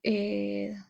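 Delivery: ordinary talk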